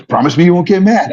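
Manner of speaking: imitating the wife, high and loud, begging; wife pleading. Wife pleading before bad news.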